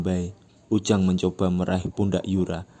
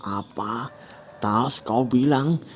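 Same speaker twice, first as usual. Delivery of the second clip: slow, mocking disbelief; cruel scorn; 5 semitones higher, slower than usual. Yura mocking Ujang about the bag, cruel. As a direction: sneer at him cruelly. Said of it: "dengan kejam Yura bilang".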